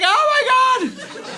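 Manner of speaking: mock gasp. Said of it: "I was like".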